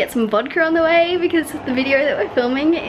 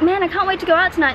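excited; 7 semitones higher, 3 dB louder, faster than usual. Retold or imitated. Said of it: "I was like".

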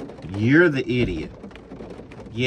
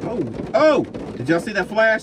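excited at lightning; 7 semitones higher than usual. Real, not acted. Trucker seeing lightning ahead, excited higher call. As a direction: point it out to everyone.

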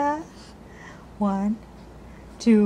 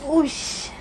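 ordinary talk

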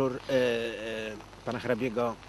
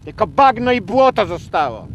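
high, loud lament falling at the end; theatrical, anguished indignation; bitter outcry; 10 semitones higher, 9 dB louder than usual. Acted. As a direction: wail the ruin out indignantly. Bitter lament over ruined ponds.

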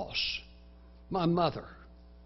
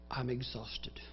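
weary inner voice; 3 semitones lower, 7 dB quieter than usual. Acted. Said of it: "the voice that says".

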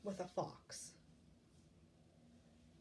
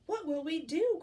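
high, loud wail; hammed-up worried duck; alarmed cry; 7 semitones higher, 8 dB louder than usual. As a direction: cry out in storybook panic. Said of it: "cried Ducky Lucky".

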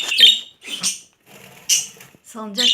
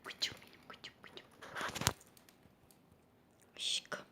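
whispered pet talk, very soft; tender whisper; 20 dB quieter than usual. Real, not acted. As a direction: coo softly to the bird without waking it. Whispering fondly to pet bird.

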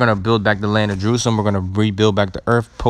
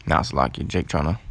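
quiet aside; 5 semitones lower, 5 dB quieter than usual. Real, not acted. Aside to Salakia while fixing things.

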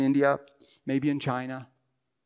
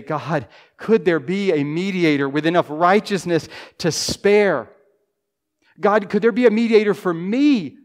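louder, higher cry for a mediator; fervent prayer; 3 semitones higher, 6 dB louder than usual. Pretend. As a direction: cry out to God. Voices a prayer.

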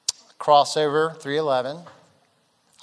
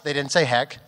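ordinary delivery

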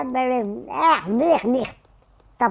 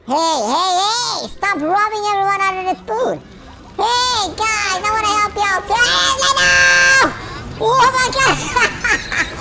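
much higher, loud calls; calling out to the other players, loudly; yelling out; 8 semitones higher, 4 dB louder than usual. Real, not acted. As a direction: shout across to the others.

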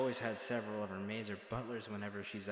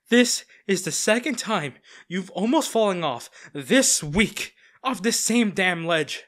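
louder and higher, fed-up warning; exasperated scolding; 6 semitones higher, 9 dB louder than usual. Acted. Bodyguard scolding the girl on the balcony, exasperated and loud. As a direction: tell her off, worn out by her clumsiness.

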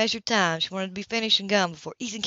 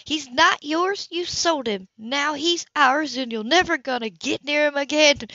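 high, loud, slow and forceful; enraged; 6 semitones higher, 5 dB louder than usual. A narrator's reading. Grace defends the boy from the mother who sold him, enraged. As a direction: drive each claim home in slow anger. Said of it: "Rage tore through her like a sword".

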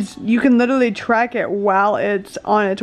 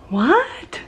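surprised; 3 semitones higher than usual. Real, not acted. Unexpected surprise in kitchen.

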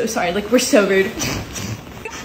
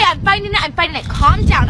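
usual speaking voice

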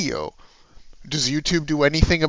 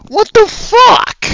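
a sudden, higher, much louder yell of disbelief; furious shout; 13 semitones higher, 10 dB louder, slower than usual. Real, not acted. Ranting at the YouTuber who filed the strike, furious shout. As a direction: blow up in outrage at the copyright striker.

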